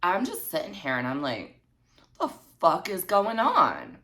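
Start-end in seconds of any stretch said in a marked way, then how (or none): 2.2-4.0 high, slow disbelief; retold bafflement. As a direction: stretch it out high, utterly baffled. Baffled reaction to texts.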